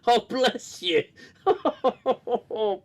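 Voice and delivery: amused blessing, laughing as he says it; laughing bless you. Real, not acted. Laughing as he blesses a sneeze.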